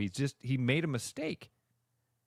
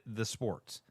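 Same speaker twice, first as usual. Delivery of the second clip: ordinary delivery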